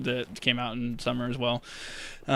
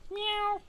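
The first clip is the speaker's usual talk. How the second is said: imitated meow; 4 dB quieter than usual. Imitating a cat to lure it.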